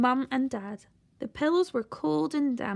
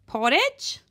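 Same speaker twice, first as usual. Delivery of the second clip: mishearing grandma; 4 semitones higher, 4 dB louder than usual. A narrator's reading.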